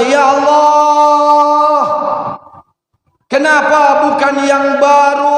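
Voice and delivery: long, high chanted cry; dying man's shout. Acted. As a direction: hold each cry high and drag it out. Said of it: "teriak lagi suami saya". (teriakan tinggi memanjang orang sekarat)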